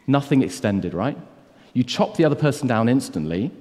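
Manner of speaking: ordinary talk, nothing marked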